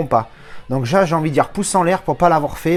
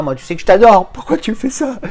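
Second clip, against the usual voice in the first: mock betrayed plea; 7 semitones higher, 6 dB louder than usual. Fan pleading with Sandra about her bad film, mock heartbroken. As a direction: pitch jumps up on the question, theatrical hurt.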